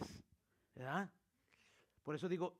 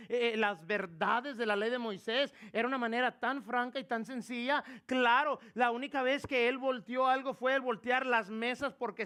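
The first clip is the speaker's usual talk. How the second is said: loud, driving sermon voice; fiery pulpit delivery; preaching at full force; 5 semitones higher, 7 dB louder than usual. Acted. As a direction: preach it hard to the congregation.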